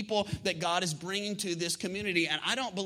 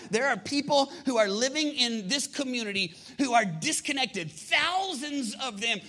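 raised, urgent sermon voice; impassioned preaching; 4 semitones higher than usual. Real, not acted. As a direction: proclaim the vision to a congregation. Preacher proclaims the church's vision.

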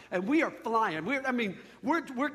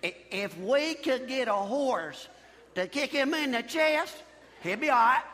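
Alabama horse farmer. Acted. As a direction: draw it out like a country horse farmer. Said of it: "The horse farmer's like".

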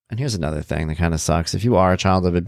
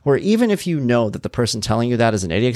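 ordinary talk

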